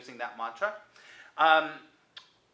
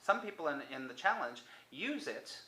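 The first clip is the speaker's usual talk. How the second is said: ordinary talk, nothing marked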